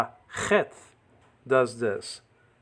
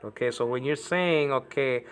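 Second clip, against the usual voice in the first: ordinary talk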